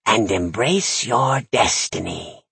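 slow, dark crone voice; ominous witch. Acted. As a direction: intone it like a prophecy. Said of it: "she said ominously".

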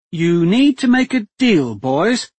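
a hostage duchess coolly taking charge; steady duchess voice. A narrator's reading. Captive duchess telling the men to make a deal, steady and firm.

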